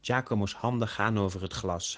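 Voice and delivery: ordinary delivery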